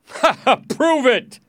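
loud, high scornful laugh; jeering laugh into a shouted dare; mocking laugh. A narrator's reading. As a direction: laugh in their faces. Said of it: "he laughed".

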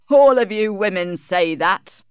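usual speaking voice

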